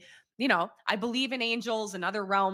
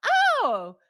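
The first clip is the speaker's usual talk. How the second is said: surprised oh; 8 dB louder than usual. Retold or imitated. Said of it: "I was like".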